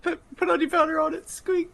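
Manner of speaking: high squeaky rat voice. Acted.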